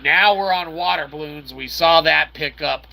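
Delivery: normal talking voice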